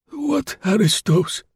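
put-on high, slow, bewildered old voice; old man playing innocent; puzzled old man. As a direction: drag it out, baffled. Grosjean feigns confusion.

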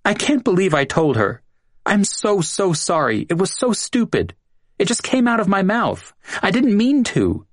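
high voiced Julia, pleading sorry; tearful apology. A narrator's reading. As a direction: pour out a guilty apology in a high voice.

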